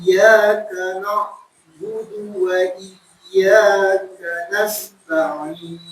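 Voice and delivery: slow, chanted tajwid recitation; Quran recitation. Shown as a demonstration. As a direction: recite the verse slowly, melodically.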